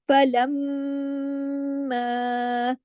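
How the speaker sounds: फलम्मा with the doubled mim hummed very long, very slow; long ghunna. Shown as a demonstration. Teacher demonstrates the great ghunna, very slow. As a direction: hold the mim of फलम्मा through the nose. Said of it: "काफी लंबा गुन्ना होगा".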